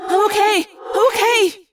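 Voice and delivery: high, urgent reassurance; cried out. Acted. Wounded Cassie insisting to Rachel, high and urgent. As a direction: gasp it out brave but hurt. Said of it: "she cried".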